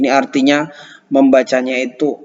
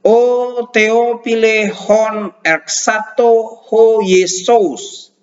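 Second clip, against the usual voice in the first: slow, high, careful reading of ancient Greek; 7 semitones higher, much slower than usual. Shown as a demonstration. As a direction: read the Greek slowly, word by word. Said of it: "Jadi kita kalau baca ini". (membaca teks Yunani dengan lambat)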